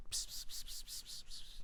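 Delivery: soft hissing psst to call a dog; whispered psst, psst to a pet. Real, not acted. Calling the dog over.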